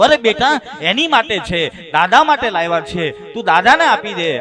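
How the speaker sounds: coaxing father. Retold or imitated. Doctor coaxing his little son to return the bell. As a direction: coax the child to give it.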